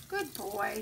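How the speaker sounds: soothing the dog during the bath; calm reassurance. Real, not acted. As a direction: settle him down gently. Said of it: "keep your voice really positive, really calm".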